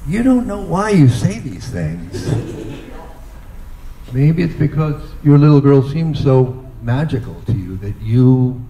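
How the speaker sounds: ordinary delivery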